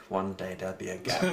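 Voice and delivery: low menacing wolf; scheming wolf. Acted. Captive wolf eyeing the fence, low scheming voice.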